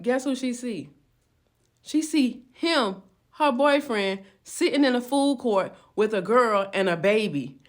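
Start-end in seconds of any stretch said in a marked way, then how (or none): none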